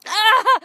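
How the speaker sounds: loud high acted yelp; re-enacted scream of horror; horrified yelp. Mother who just swallowed breast milk, horrified yelp.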